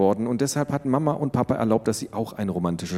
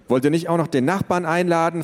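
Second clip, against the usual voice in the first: sarcastic; 8 semitones higher than usual. A narrator's reading. Sulking teen sarcasm.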